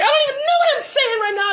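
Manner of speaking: high, loud shouted threat; retold screaming. Threatening the stalker to leave, high and loud. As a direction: yell it at him full force. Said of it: "I was just screaming".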